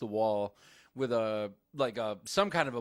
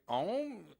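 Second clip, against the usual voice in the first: put-on French-accented word, ever more drawn out and louder; obnoxious French accent; 5 semitones higher, 7 dB louder, much slower than usual. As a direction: build from high question to loud French-accented wail. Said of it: "Like this obnoxious French accent".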